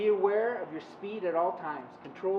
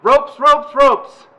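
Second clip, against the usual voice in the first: shouting; 5 semitones higher, 18 dB louder, much slower than usual. Real, not acted. Fast rope command shouted.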